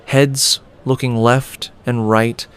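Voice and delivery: ordinary delivery, nothing marked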